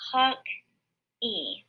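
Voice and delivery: hawk-e, two separate syllables; syllables split. Shown as a demonstration. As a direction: break hockey into hawk-e. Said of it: "I hear two syllables".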